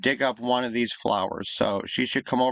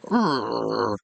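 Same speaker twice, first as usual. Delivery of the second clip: imitated dog grumble; a drawn-out mock growl, like a grumbling dog; mumbly grumbly growl. Owner imitating her attention-seeking dog, mumbly grumble. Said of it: "mumbly grumbly".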